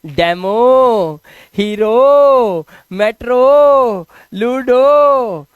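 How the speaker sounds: "डेमो, हीरो, मेट्रो, लूडो" loud with very long final O; final O sound. Shown as a demonstration. Teacher models words ending in O, loud drawn-out final O. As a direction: call out each word slowly, holding the O in "डेमो, हीरो, मेट्रो, लूडो". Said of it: "तो ओ को ओ पढ़ते हैं".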